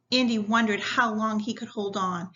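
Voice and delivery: ordinary delivery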